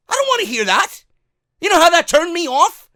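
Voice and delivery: disgusted fan venting at the network, angry and loud; angry outburst. Real, not acted. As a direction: snap it out, truly fed up. Ranting about the podcast.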